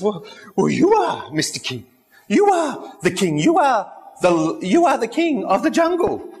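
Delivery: high, nervous antelope flattery; a lightly voiced antelope buttering up the king; flattering antelope. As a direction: squeak out the praise to please the lion.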